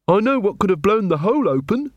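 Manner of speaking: a hint of Angua, thoughtful; young female guard offering a guess. A narrator's reading. Angua's voice in the story.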